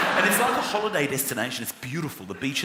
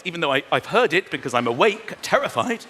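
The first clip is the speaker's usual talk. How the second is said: usual speaking voice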